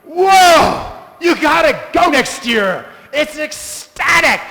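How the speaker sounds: high, loud excited gush; pilgrim back from Mayapur urging a friend, excited; fired-up whoa. Retold or imitated. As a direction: rave about the festival, wide-eyed. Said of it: "you're like".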